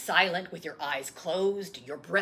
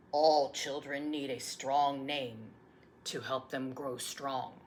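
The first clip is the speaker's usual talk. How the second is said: plain reading voice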